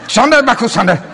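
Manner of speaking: high mock babbling breaking into a laugh; mock tongues. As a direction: babble and crack up. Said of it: "little scared sheep, going".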